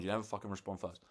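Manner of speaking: normal talking voice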